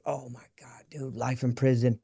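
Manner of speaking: whispered, breathless realization; shocked whisper to himself; retold whisper. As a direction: whisper it as the horror sinks in. Realizing he nearly killed man.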